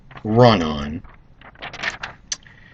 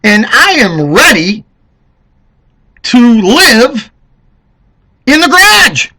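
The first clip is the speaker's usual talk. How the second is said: higher, louder complaining voter, building to the end; rising to a loud, high wail on garage; mocking fed-up Americans; 8 semitones higher, 6 dB louder than usual. Vlogger imitating voters complaining to Dems, rising whine. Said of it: "Americans are going".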